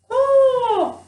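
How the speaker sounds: very high loud squawk; loud crow caw. Acted. Crow offering to lead Gerda, loud high caw.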